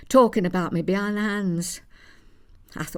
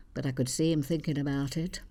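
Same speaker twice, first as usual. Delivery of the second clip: ordinary talk, nothing marked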